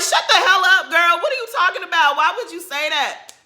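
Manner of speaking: "Shut the hell up" yelled high and loud; retold screaming. Vlogger yelling at Robin on the TV screen, loud and high. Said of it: "when I tell you I was screaming".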